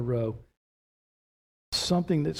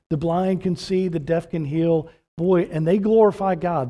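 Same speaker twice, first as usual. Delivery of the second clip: louder, higher, driving preacher's voice; full-force preaching; 4 semitones higher, 6 dB louder than usual. Acted. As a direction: fire up the congregation.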